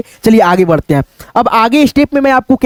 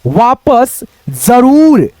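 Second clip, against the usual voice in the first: slow, drawn-out reading; much slower than usual. Shown as a demonstration. Teacher dictating slowly to the class. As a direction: stretch each word as if dictating. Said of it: "उसके बाद से यहाँ पे देखो".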